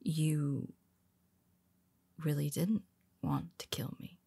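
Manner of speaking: soft, unvoiced, hushed realization; stunned whisper. Hero realizing his nemesis never wanted him dead, whispered softly. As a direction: whisper it as the truth sinks in.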